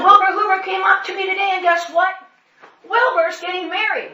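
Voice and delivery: high, bright-eyed loud announcing; teasing excitement. Retold or imitated. Pastor teasing his congregation with news, high and excited. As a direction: build up the surprise.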